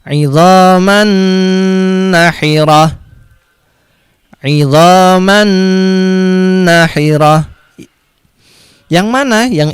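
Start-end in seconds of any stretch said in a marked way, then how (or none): 0.0-2.9 slow recited "idzaman nahirah" with a humming held n; drawn-out "idzaman" hummed into "nahirah"; held nasal nun. Shown as a demonstration. 4.4-7.4 slow recited "idzaman nahirah" with a humming held n; drawn-out "idzaman" hummed into "nahirah"; held nasal nun. Shown as a demonstration.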